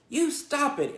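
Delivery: high, loud cry; cried out. Acted. Fern crying out to stop her brother hitting the spider. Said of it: "cried Fern".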